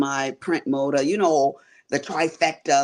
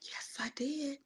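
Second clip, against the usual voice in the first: hushed whisper, much softer; very soft, voiceless whisper; whispered aside; 11 semitones higher, 16 dB quieter than usual. Real, not acted. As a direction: whisper it like a proud little secret. Proud aside while showing her work.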